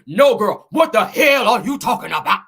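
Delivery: loud, put-on indignant woman; rival's indignant voice. Acting a rival's angry comment to a gossip host, indignant. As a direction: blast it out in her voice, every word punched. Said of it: "Let me say it in her voice".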